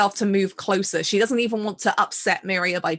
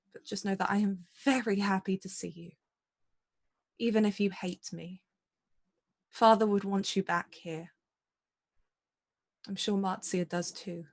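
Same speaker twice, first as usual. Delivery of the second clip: restrained, softer apology; careful, heartfelt and subdued; sorrowful apologetic older sister; 7 dB quieter than usual. Acted. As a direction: hold composure while apologizing to a sister. Apology in character to estranged sister.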